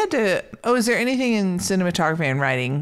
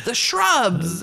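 imitated movie bandito; 6 semitones higher than usual. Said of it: "And he goes".

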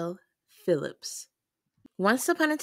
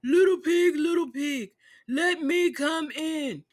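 higher, louder big bad wolf; 7 semitones higher, 4 dB louder than usual. A narrator's reading. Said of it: "and said".

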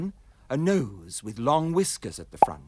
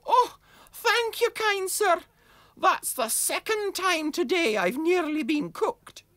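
grateful little badger. Badger thanks rag and bone man.

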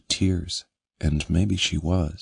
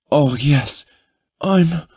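breathless ecstasy; 11 semitones higher, 6 dB louder, slower than usual. Acted. Heroine near climax, cut off.

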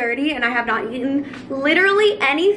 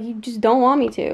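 ordinary talk

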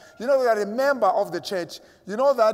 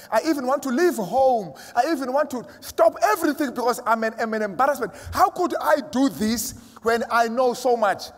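higher, louder impassioned sermon; full-force preaching; 4 semitones higher, 6 dB louder than usual. Acted. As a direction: drive it home to the church.

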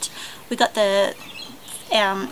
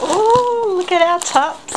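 delighted gasp; 8 semitones higher, 5 dB louder, slower than usual. Real, not acted. Vlogger seeing her group's new dance tops, delighted gasp. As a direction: gasp then a high loud ooh.

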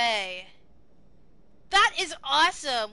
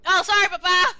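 called-out sorry, louder; loud apology; 4 semitones higher, 7 dB louder, faster than usual. Real, not acted. Streamer calling out sorry to her dad. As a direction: shout an apology across the room.

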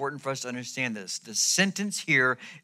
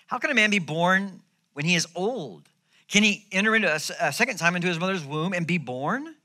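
puzzled; 6 semitones higher, 6 dB louder than usual. Acted. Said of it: "he's not arguing, he's trying to understand".

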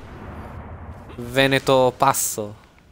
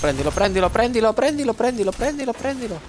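shrill urgent yells, rising high; excited high yelling; 11 semitones higher, faster than usual. Real, not acted. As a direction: yell it high and frantic. Player yelling during game fight.

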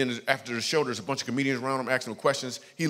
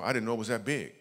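usual speaking voice

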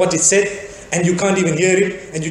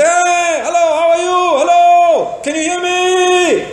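high-pitched holler, stretched word endings; hammed-up phone shouting; 10 semitones higher, 3 dB louder than usual. Caller in Singapore talking to the U K, high drawn-out shouting. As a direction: yell as if the line must reach overseas.

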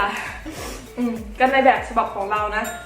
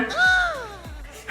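gasp; 14 semitones higher, 4 dB quieter than usual. Real, not acted. Spicy noodle challenge big bite.

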